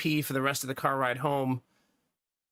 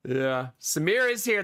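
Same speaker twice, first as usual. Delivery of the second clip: normal talking voice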